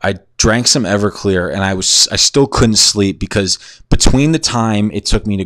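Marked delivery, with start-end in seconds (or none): none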